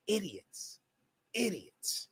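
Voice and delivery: very soft, dismissive aside; quiet, scornful one-word jab; muttered contempt. Real, not acted. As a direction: drop it right down, insult under your breath. Dismissing the trolls under his breath.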